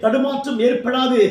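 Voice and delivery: forceful, exhorting sermon voice; fervent preaching. Real, not acted. Preacher urging discouraged believers not to fear, fervent preaching. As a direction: preach with urgent, driving conviction.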